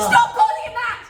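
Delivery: very high, loud yell; defending her new partner with a shout; furious yell. Acted. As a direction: shout him down.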